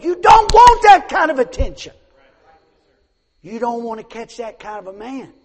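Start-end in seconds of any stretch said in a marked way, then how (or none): 0.0-1.9 much higher, much louder warning shout; preacher's shout. Real, not acted. Pastor warning the girls off bad attention, full-voiced shout. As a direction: cry it out as a warning.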